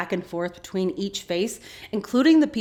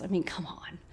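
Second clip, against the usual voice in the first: conspiratorial whisper; whispered aside; 10 dB quieter than usual. Real, not acted. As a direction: whisper it to the viewer. Aside to viewer about Sawyer.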